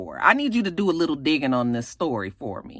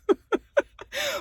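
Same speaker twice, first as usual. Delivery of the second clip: high squeal of laughter. Real, not acted. Laughing at nickname.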